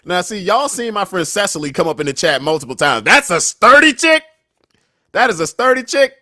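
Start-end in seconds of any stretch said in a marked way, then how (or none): 3.0-6.1 hyped up. Real, not acted. Hyping Cecily as sturdy.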